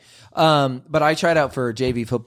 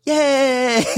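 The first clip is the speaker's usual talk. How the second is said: cheering; 11 semitones higher than usual. Retold or imitated. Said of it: "all the nerds go".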